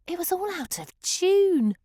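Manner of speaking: ordinary delivery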